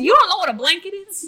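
incredulous. Acted. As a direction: gasp at such ignorance. NPC incredulous at player.

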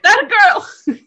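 high, loud, laughing cry of delight; delighted exclaim. Retold or imitated. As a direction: squeal it out, laughing with joy. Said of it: "I was like".

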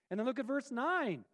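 ordinary delivery

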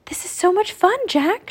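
high, bubbly raven chatter; excited croak. A narrator's reading. Annie landing beside Jack, excited croak. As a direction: pitch it up with gleeful excitement. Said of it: "she croaked".

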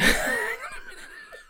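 breathless wheezing laugh; airy, trailing giggle fit; laughter. Real, not acted. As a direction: laugh helplessly after a slip. Laughs at own wording.

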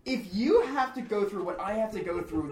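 worked-up venting; livid rant. Real, not acted. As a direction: rant at the co-hosts, fed up with them. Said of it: "I am livid".

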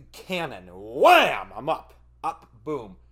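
normal talking voice

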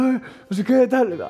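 crowd shouting. Retold or imitated. Crowd spotting Brad Pitt behind the curtain, high excited yell. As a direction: shout over everyone like a frantic fan. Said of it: "todo el mundo gritando".

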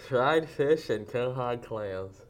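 slow, garbled through a mouthguard; wearing a mouthguard. Real, not acted. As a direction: push words out past the guard. Mouthguard game reading.

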